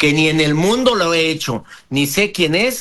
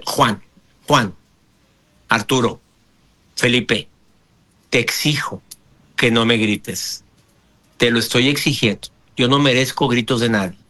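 low-pitched, measured insistence; stern and controlled, with pauses between phrases; firm demand; 6 semitones lower than usual. Shown as a demonstration. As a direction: state each phrase as a demand, voice kept low. Demonstrating a firm demand, step three.

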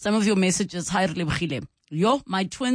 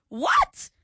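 higher, real disbelief; shocked what; 9 semitones higher than usual. Real, not acted. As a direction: react to the neighbour's story in shock. Host shocked by caller's story.

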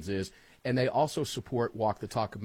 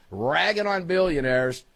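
usual speaking voice

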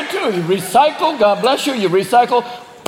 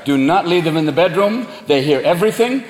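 usual speaking voice

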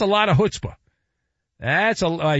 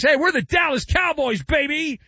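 much higher, swaggering mock boast; cocky boast; 10 semitones higher than usual. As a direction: puff up like a cocky league exec. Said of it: "the English Premier League thinks".

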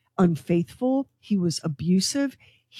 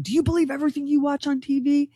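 higher, fast mock yell; retold yelling; 8 semitones higher, faster than usual. Host imitating the pastor yelling at the restaurant manager. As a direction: toss off an indignant mock yell. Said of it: "he started yelling".

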